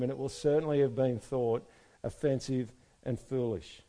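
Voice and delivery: ordinary talk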